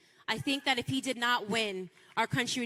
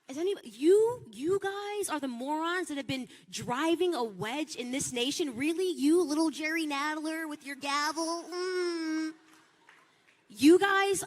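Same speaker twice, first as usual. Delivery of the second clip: retold angry scorn; 4 semitones higher than usual. Confronting Democrats at the hearing, mock scornful and higher. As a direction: sneer at them, then punch the last words. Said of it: "I was immediately furious".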